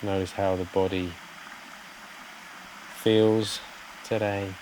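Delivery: soft, unhurried meditation voice with long pauses; guided meditation. Real, not acted. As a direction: gently guide listeners to settle, phrase by phrase.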